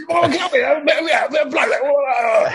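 high excited shouts; shouting. Retold or imitated. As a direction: call him up on stage. Shouting Marriott onto stage.

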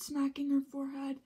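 usual speaking voice

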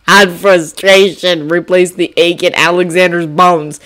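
usual speaking voice

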